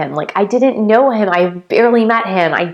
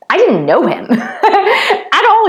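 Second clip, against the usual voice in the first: laughing; 11 semitones higher, 4 dB louder, slower than usual. Real, not acted. Laughing about barely knowing him.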